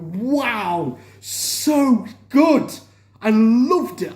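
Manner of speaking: thrilled fan raving about the finale, excited; excited gushing. Real, not acted. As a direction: let the excitement spill out. Gushing over the episode.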